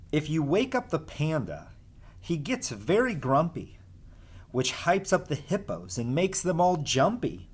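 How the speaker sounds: lively sing-song storybook reading; reading to children. Acted. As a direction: read the rhymes as if to a class of kids. Reads rhyming picture book aloud.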